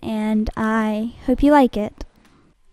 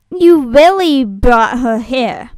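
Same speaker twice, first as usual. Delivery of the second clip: loud, drawn-out accusation; heated, forceful reproach; angry confrontation; 9 dB louder, slower than usual. Acted. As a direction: lay into them, much louder and slower. Audition, angry confrontation over a traitor.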